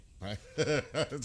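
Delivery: loud high laugh; burst of laughter. Real, not acted. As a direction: laugh out loud at own joke. Joking about park at night.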